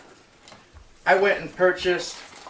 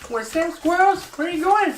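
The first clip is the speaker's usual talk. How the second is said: very high playful calling voice; 13 semitones higher, 4 dB louder than usual. Real, not acted. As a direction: call squirrels in a squeaky voice.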